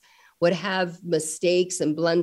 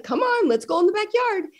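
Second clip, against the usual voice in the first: a touch of cheery dad; 12 semitones higher than usual. Retold or imitated. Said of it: "he's like".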